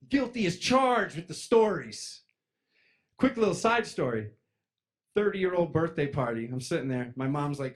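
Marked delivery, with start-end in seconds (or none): none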